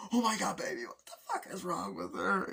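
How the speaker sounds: high, loud in shock; horrified shriek. Retold or imitated. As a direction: cry out in horror. Woman horrified by death.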